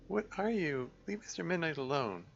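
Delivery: put-on frightened girl. Frightened girl confronts shadow.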